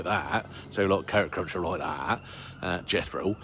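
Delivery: low, soft mock West Country yokel; West Country accent. Shown as a demonstration. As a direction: do a West Country carrot cruncher. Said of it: "with a West Country accent".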